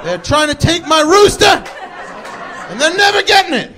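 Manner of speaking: high-pitched mock-furious rant; flipping out. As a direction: rant at full tilt as the outraged rooster owner. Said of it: "he just flips out".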